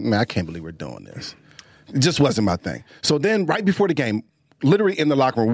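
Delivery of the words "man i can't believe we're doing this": muttered disbelief. Retold or imitated. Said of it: "I'm like".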